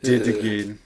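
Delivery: put-on low, weary Russian accent; resigned, badly faked Russian; attempted Russian accent. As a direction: sigh it out in mock Russian. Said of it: "How do I sigh in Russian".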